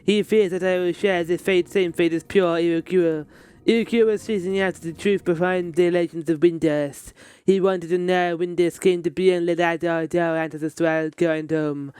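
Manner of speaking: a hint of French accent in the dialogue read; lightly voiced game character. Streamer reading a game character's lines aloud.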